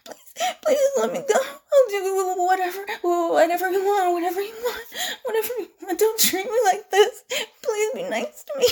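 high, sobbing plea; crying, frightened and worn down; tearful begging. Acted. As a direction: plead weakly through tears. Victim begging attacker to stop.

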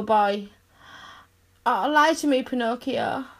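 ordinary talk, nothing marked

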